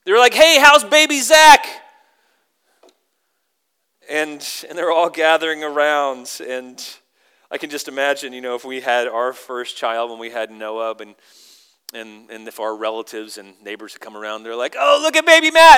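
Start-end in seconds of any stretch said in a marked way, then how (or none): 0.3-1.6 loud, high, drawn-out greeting; hammed-up excited hello, much higher and louder; gushing neighbor. Imagined neighbors greeting the baby. 14.8-15.8 put-on high-pitched, loud baby fussing; put-on excited voice, way up high; gushing relatives. Imagined relatives cooing over baby.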